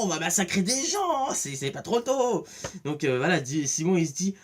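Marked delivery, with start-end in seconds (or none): 0.0-2.5 gleeful. Retold or imitated. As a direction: relish the coming massacre. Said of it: "il est content".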